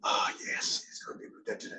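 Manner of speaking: a soft, whispered Pierce greeting; imitating Pierce. Imitating Pierce greeting him on set in a soft whisper. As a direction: breathe it out like a scripted film line. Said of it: "It's like he's reading a script".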